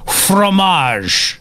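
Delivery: hammed-up French fromage; a hint of a French accent; bad French accent. Shown as a demonstration. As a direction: put on a clumsy French accent. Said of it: "My French accent has never been good".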